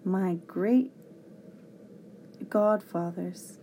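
slow, weighty exclamation; heartfelt, drawn-out oath; with feeling. A narrator's reading. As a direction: say it with feeling, pausing mid-oath. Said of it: "he said with feeling".

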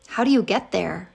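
plain reading voice

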